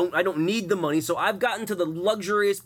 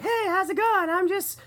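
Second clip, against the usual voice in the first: much higher, slow put-on girl voice; slower than usual. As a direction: coo it like a bored phone girl. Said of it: "I eventually nailed the voice".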